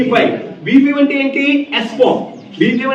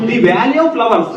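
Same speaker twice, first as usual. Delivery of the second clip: slow reading aloud; much slower than usual. Shown as a demonstration. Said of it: "flowers కంటే ముందు ఏముంది?".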